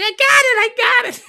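high, loud squealing quote; excited squeal. Retold or imitated. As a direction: squeal it out like a giddy girl. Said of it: "She's like".

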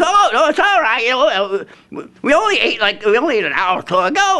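high, breezy hillbilly brush-off; hillbilly Joe. Retold or imitated. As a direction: wave it off in a high twang. Joe answering, voiced.

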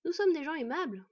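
high, loud mock politeness. A narrator's reading. Boy taunting bandit chief, mocking. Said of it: "confirma moqueusement Bord".

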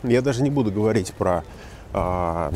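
usual speaking voice

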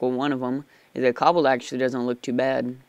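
usual speaking voice

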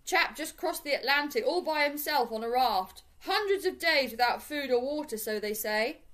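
loud shout over his shoulder. A narrator's reading. Passer-by shouting back over his shoulder without stopping, shouted. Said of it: "looked back over his shoulder and shouted".

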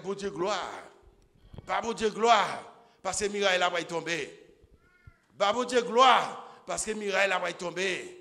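loud, high repeated glory cry; praise shout. Acted. Preacher voicing the people's cry before the walls, shouting. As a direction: call out to God like the crowd at Jericho.

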